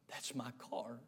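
very low, hushed and tearful; tearful recognition. Retold or imitated. Old man finding his lost car, hushed tearful recognition. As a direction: barely voice it, overcome. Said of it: "tears streaming down his face".

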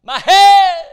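put-on high, loud cry of a child in pain; a child's shout of pain; screaming in pain. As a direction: scream it out like a hurting boy. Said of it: "he screams to his daddy".

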